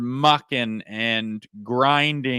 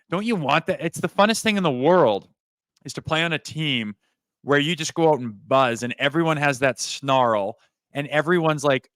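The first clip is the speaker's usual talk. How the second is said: ordinary delivery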